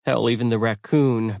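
ordinary delivery

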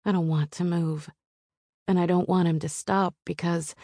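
usual speaking voice

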